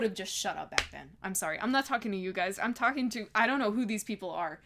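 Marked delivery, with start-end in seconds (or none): none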